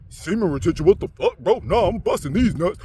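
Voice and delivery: loud dim caveman grunt. Retold or imitated. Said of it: "I do the caveman voice".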